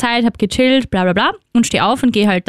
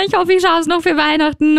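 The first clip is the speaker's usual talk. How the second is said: mock tearful; 7 semitones higher than usual. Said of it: "ich ruf heulend zu Hause an".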